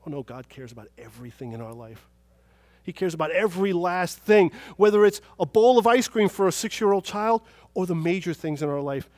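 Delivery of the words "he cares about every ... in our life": loud, punchy sermon delivery; emphatic preaching. Real, not acted. Preacher assuring the congregation God cares about everything, emphatic. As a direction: drive the point home from the pulpit. Preacher drives the point home.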